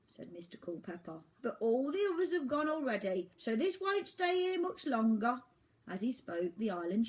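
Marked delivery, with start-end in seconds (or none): 1.4-5.4 high, loud lecturing bird voice; scolding old albatross. Acted. Albatross lecturing Oliver, high scolding voice. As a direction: lecture the ignorant youngster.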